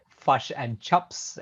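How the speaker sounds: slow, drawn-out mock New Zealand accent, "fush" and "chups". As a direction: tease the New Zealand vowels, slowly. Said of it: "pick on the New Zealand accent".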